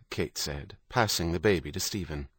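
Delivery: ordinary delivery, nothing marked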